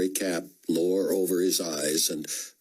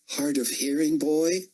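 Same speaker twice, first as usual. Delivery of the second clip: stern rebuke; 10 semitones higher than usual. A narrator's reading. Officer scolding soldier.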